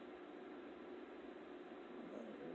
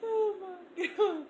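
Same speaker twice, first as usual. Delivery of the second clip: laughing cry; 7 semitones higher, slower than usual. Real, not acted. Reacting to the tongue.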